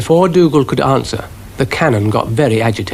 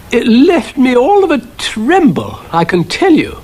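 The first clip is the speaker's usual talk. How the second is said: trembling; 12 semitones higher, 3 dB louder than usual. A narrator's reading. Train telling Florence of the gang, trembling. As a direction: let the voice tremble.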